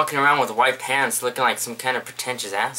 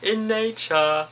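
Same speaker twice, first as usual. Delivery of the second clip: much higher, hammed-up Transylvanian doctor with cackles; Doctor Spankmans Transylvanian accent; 7 semitones higher, slower than usual. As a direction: play a campy Transylvanian doctor, high-pitched. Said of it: "I am Doctor Spackmans from Transylvania".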